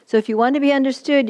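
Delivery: ordinary talk, nothing marked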